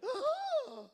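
loud, high offended gasp; hammed-up French outrage; mock French gasp. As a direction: gasp "oh" in theatrical shock. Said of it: "as French people do".

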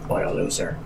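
soft, voiceless muttered jab; hushed in-character put-down; whispered aside. Acted. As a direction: whisper it under your breath. Acted overheard reply to whispered wish.

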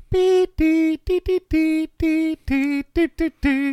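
very high sung beeping; phone ringtone imitation. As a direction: chirp it like a ringing cell phone.

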